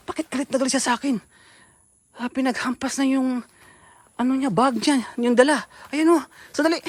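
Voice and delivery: high, loud rattled reporting; flustered alarm. Real, not acted. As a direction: shaken and rushed.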